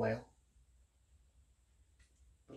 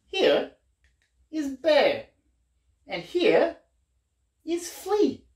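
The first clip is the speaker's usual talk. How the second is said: storybook reading; 9 semitones higher, 5 dB louder, much slower than usual. A narrator's reading. Reading picture book to children.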